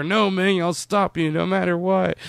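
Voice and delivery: high, insistent hero mimicry; mock heroic defiance. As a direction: imitate a single-minded hero, pitched right up. Repeats Flash's refrain over practical question.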